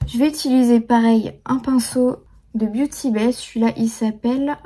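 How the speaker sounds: ordinary talk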